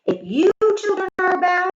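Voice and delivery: high, loud menacing teacher voice; warning witch teacher. A narrator's reading. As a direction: threaten the class like a wicked teacher. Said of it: "she warned".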